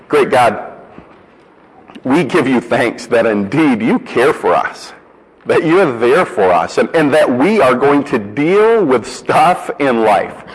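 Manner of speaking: earnest spoken prayer to God; closing prayer. Acted. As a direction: pray it sincerely for the congregation. Pastor leads closing prayer.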